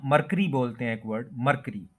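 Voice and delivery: मरकरी as "mar-kree", no "y" glide; common mispronunciation. Shown as a demonstration. Teacher showing how we commonly say it, mispronounced. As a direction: say मरकरी with plain "k" straight into "ree". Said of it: "मरकरी नहीं बोलेंगे".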